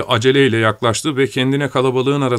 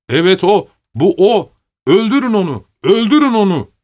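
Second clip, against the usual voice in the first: high, loud cries in short bursts; mob shouting; 9 semitones higher, 5 dB louder than usual. A narrator's reading. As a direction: whip up a lynch mob. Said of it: "diye bağırmaya başladılar".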